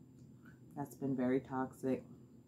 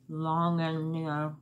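talking around food; muffled through chewing; mouth full, chewing; 2 semitones higher, slower than usual. Real, not acted. Seen 1: bites chicken at -1.0, food visible in mouth early on.

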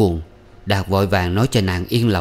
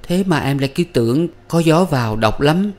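higher-pitched character voice of a woman; young woman's voice; 7 semitones higher than usual. A narrator's reading. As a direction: voice her line high and lilting. Quỳ's line, voiced by narrator. (giọng phụ nữ trẻ, cao)

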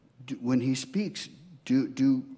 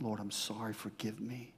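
much softer repentant murmur; quiet prayer; 2 semitones lower, 11 dB quieter than usual. Pretend. Sinner asking the Lord's forgiveness, softly. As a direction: pray it under your breath.

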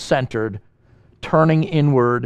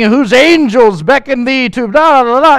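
loud mock pulpit prayer, higher pitched; wordy praying preacher; 8 semitones higher, 6 dB louder than usual. Young preacher launching into a wordy prayer, mock-lofty and loud. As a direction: lightly act out a long-winded preacher addressing God. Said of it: "he's launching into this wordy prayer".

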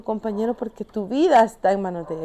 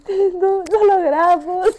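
high-pitched, giddy and louder; happy celebration; 9 semitones higher, 4 dB louder, slower than usual. Retold or imitated. Climber telling her sherpa they made it, overjoyed. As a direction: squeal it happily, close to laughing. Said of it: "que yo bien feliz".

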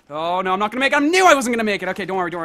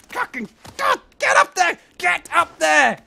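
much higher, straining yell; frantic yell; 8 semitones higher, 3 dB louder than usual. Real, not acted. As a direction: scream the bunny up the wall. Urging the rabbit upward.

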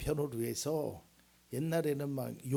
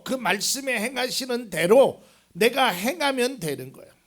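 loud, raised preaching voice; 7 semitones higher, 13 dB louder than usual. Real, not acted. Preacher tells congregation about God and ability, preaching loudly. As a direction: drive each phrase home at full force.

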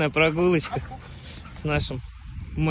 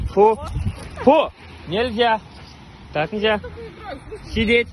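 firm dog command; 5 semitones higher than usual. Real, not acted.

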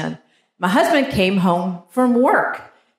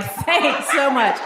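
slow, dry mock thanks; sarcastic wife thanking husband, slow; 4 semitones higher, much slower than usual. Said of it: "I'm like".

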